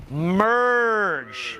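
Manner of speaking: slow stretched word; drawn out. Shown as a demonstration. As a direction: hold the word long. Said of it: "do this with me".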